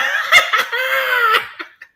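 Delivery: loud cackle up high; high shrieking laugh. Real, not acted. Laughing at the joke, high shrieking laugh.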